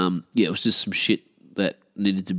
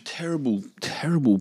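words stretched through a real yawn; 7 semitones higher than usual. Real, not acted. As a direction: talk through a surprise yawn. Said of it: "Never yawn on the microphone".

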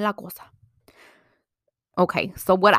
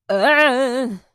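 loud, higher drawn-out "ugh"; disgusted groan; 6 semitones higher, 9 dB louder than usual. Real, not acted. Disgust at judgmental friends.

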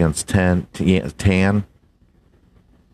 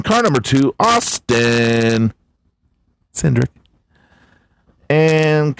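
hammed-up race announcer; 6 semitones higher than usual. Reading the NASCAR top finishers to his buddy, hyped announcer. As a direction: boom out the countdown like a race caller. Hams up the race results countdown.

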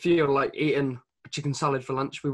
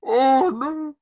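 a high, loud, laughing groan of disbelief; 11 semitones higher, 7 dB louder than usual. Real, not acted. Co-host reacting to gym-goers eating McDonald's, high laughing groan. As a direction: cry out oh no in amused horror.

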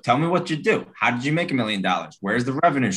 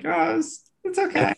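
wife voice. Retold or imitated. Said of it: "Sarah would be like".

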